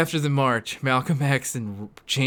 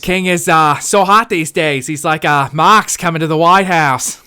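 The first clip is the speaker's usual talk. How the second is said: loud JFK impression drifting into an Australian accent; a Kennedy voice that keeps turning Australian; JFK impression slipping Australian; 8 dB louder than usual. Reading the slide as JFK in a slipping Australian accent. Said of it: "Fuck, that was, like, Australian".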